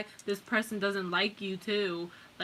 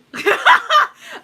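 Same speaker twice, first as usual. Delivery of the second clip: burst of laughter; 14 dB louder than usual. Real, not acted. Laughs at own joke.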